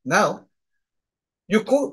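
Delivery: usual speaking voice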